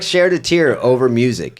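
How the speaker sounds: normal talking voice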